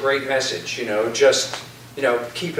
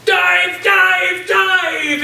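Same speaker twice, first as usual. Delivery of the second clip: much higher, louder dive command shout; urgent captain yelling at crew; shouted order; 5 dB louder, much slower than usual. Acted.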